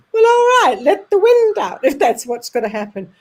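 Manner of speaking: high, loud and giggling; laughing through the words. Retold or imitated. Telling the sitter to let the wind out, laughing. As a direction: she can barely get it out for laughing. Retelling a funny seance moment.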